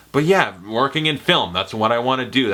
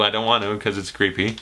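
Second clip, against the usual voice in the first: ordinary delivery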